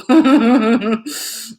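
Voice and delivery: long stuttering laugh; rippling burst of laughter. Real, not acted. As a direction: laugh at your own babble. Laughs at own babble.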